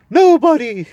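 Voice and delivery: very high, very loud hooting shout; owl shouting. Acted. Owl shouting into the deaf dwarf's ear. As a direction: shout it with all your breath. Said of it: "you needn't shout".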